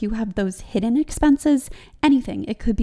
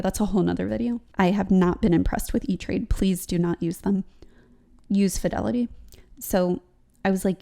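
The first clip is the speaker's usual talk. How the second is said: ordinary talk, nothing marked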